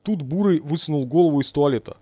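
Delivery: ordinary delivery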